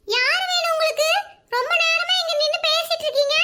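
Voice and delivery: put-on high-pitched small child voice; little granddaughter. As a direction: question strangers as a suspicious little girl. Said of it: "அவளோட பேத்தி". (சின்னப் பேத்தியின் கீச்சுக் குரல்)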